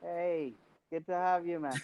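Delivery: very faint, distant voice; speaking far too quietly; barely audible. Real, not acted. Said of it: "I can barely hear you. Your voice is very low".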